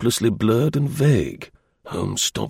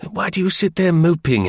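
higher, lively call to a sister; brisk brotherly exclamation; brother crying out; 7 semitones higher than usual. A narrator's reading. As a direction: call out to her, pitch lifted. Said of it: "cried her brother".

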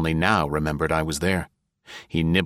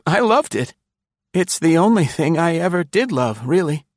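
high, close to tears; a touch of a sob, pitched high; near sob; 12 semitones higher, 5 dB louder than usual. A narrator's reading. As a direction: confess it almost sobbing. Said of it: "pathetic little laugh, a sob almost".

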